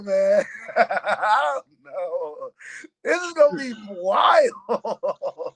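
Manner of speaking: slow, laughing through the words; real laughter at the thought of the fight. Real, not acted. Laughing over fight hype.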